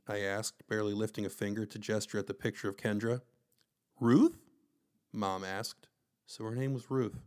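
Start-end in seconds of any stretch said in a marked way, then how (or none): 4.0-4.4 surprised question. A narrator's reading. Mother asks surprised.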